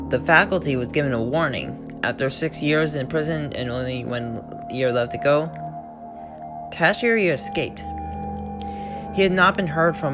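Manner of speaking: ordinary delivery, nothing marked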